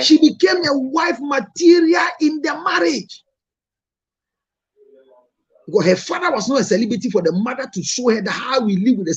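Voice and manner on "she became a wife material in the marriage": higher, slow, shouted; emphatic shout. Real, not acted. Answering the critic about his wife, slow and shouting. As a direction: proclaim it to the room.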